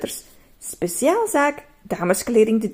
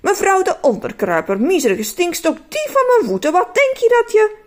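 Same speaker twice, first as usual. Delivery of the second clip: building from stern to high, loud insults; scolding the thief, climbing higher and louder; 9 semitones higher, 5 dB louder than usual. Pretend. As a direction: work yourself into a rising rant. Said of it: "Hij keek streng in de spiegel".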